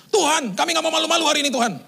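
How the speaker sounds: high, loud impassioned prayer; fervent worship leader. Retold or imitated. Worship leader praying up front, hugely fervent imitation. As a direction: imitate an over-zealous worship leader crying out. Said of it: "semangatnya minta ampun".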